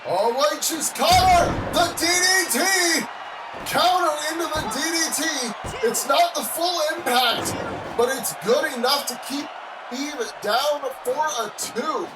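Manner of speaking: rising commentator shout, hyped; excited shouting. Real, not acted. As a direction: yell the call as it happens. Sudden counter in match.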